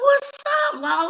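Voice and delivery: hammed-up friend shouting hello; voiced friend greeting. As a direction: play the buddy greeting high and bright.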